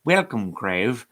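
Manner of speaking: slow, drawn-out storybook host voice; a touch hammed-up story time announcer; storyteller voice. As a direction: welcome them like a kids' TV storyteller, slowly. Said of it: "I don't know why that voice just took over me".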